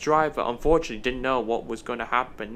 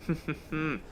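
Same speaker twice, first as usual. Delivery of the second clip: sly chuckle; 8 dB quieter than usual. Acted. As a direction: chuckle quietly to yourself. Dennis pleased with naughty idea.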